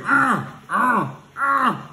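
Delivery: high loud repeated mocking ahs; mock yells. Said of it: "All you hear is".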